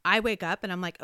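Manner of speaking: ordinary delivery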